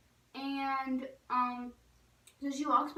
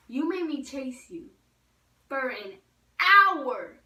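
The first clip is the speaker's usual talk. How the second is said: "hour" loud and pitched up; exasperated stress on hour; 3 semitones higher, 9 dB louder, slower than usual. Retold or imitated. Girl scolding dog after long chase, exasperated punch on "hour". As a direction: build up slowly, then burst out on "hour".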